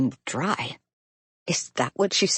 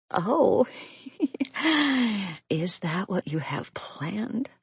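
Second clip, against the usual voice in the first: sultry whisper; 7 semitones higher, slower than usual. A narrator's reading. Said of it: "I do my best to mimic her".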